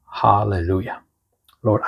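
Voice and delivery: ordinary talk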